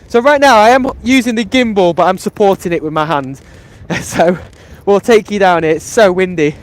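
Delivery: loud voice against the gusts; shouting over wind. Real, not acted. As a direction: project over the wind noise. Walking pier in strong wind.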